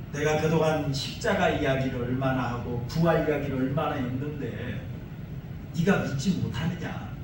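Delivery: quietly wounded, not angry; disappointed. Retold or imitated. Jesus speaking to a disciple who did not believe, hurt. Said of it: "서운함도 담겨 있었던".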